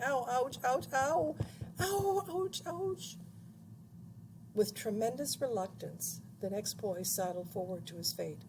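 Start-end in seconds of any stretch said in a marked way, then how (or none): 0.0-3.2 rising high string of "ow, ouch"; yelping in pain. A narrator's reading. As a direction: yelps climbing high, then fading in volume. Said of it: "and yelling".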